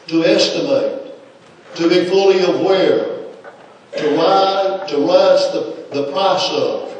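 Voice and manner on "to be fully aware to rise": usual speaking voice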